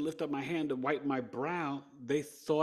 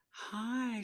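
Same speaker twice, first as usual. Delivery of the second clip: sweet nurse voice; 13 semitones higher than usual. Retold or imitated. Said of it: "she's like".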